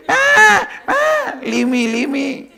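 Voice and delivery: very high, bleating lamb cry; bleating cub. Acted. Cub caught by the lion, bleating high for its mother. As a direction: bleat it out like a frightened baby sheep. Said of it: "started bleating same way".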